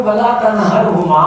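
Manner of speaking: slow, drawn-out Quranic recitation. Shown as a demonstration. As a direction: recite the verse slowly, each syllable drawn out. Quoting a Quranic verse to children. (قرآنی آیت کی آہستہ تلاوت)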